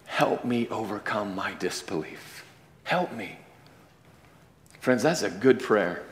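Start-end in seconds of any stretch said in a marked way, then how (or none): 0.1-3.4 soft, slow plea; father pleading softly to Jesus; quiet plea. Retold or imitated. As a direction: hush it as a humble plea. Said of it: "And then he says".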